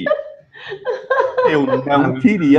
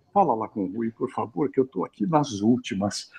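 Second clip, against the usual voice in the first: much softer, squeezed plea, bladder full; strained, voice not coming out; 5 semitones lower, 10 dB quieter than usual. Retold or imitated. Man desperate at urologist begs secretary, strained and much softer. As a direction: barely get the words out, strained. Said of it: "a minha voz não tá saindo".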